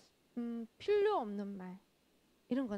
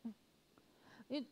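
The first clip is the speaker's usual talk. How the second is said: ordinary delivery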